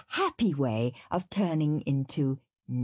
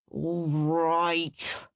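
snarl. Acted. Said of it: "snarled Henry".

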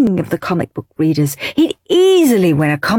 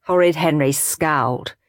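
plain reading voice

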